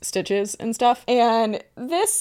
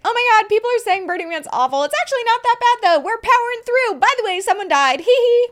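high, giddy, flippant chirp; mock her breezy careless cheer; mocking airhead voice; 11 semitones higher than usual.

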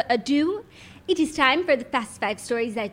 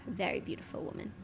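much softer, near-whispered admiring; hushed aside; 5 semitones lower, 16 dB quieter, faster than usual. Pretend. Harry admiring Kim to his brother, hushed aside. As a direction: breathe it out almost silently.

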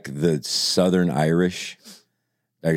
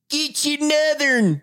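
high, slow Southern twang; thick Southern drawl; slower than usual. Retold or imitated. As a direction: imitate the Mississippi coffee guy's drawl. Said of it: "And this guy goes".

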